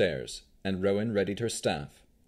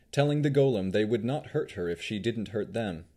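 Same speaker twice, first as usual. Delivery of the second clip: usual speaking voice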